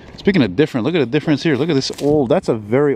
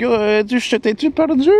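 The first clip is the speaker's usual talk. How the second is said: high mock little-lady voice in French; high imitated lady asking in French; imitating old lady; 11 semitones higher than usual. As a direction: put on the little old lady's voice. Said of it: "this little lady walking behind me".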